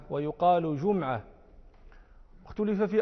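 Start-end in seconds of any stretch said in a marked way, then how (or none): 0.8-1.2 جمعة said "JUM-'a", no vowel after the m; sukun on the mim. Shown as a demonstration. Teacher listing the word's three vowellings, جمعة as "jum'a". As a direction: close the first syllable of جمعة on m. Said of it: "تثليث للحركة، فهي مح-، مثلثة الميم".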